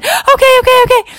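very high, loud breathless okays; frantic relief. Acted. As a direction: gasp out relief.

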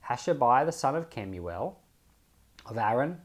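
ordinary talk

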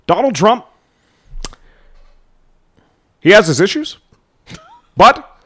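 short bursts with a pause before the but; Obama's speech pattern. Retold or imitated. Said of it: "He says two words very fast".

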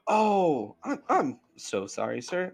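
flustered bartender. Acted. Bartender apologizes.

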